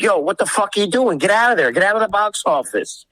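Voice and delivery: high-pitched reenacted angry yelling; retold screaming. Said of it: "And I start screaming".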